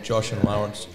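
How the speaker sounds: ordinary talk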